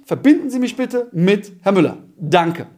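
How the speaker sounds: falling order, voice dropping at the end; commanding tone. Shown as a demonstration. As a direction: say it as a firm command. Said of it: "du musst immer im Befehlston sprechen". (Sekretärin bekommt einen klaren Befehl, Befehlston)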